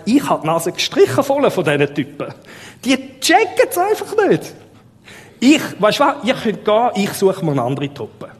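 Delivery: gespielt entnervt, plötzlich viel höher und lauter; genervter Jesus. Pretend. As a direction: hau die Jünger empört in den Sack, übertrieben. Prediger spielt genervten Jesus. (Prediger spielt einen Jesus, der die Jünger satthat, empört)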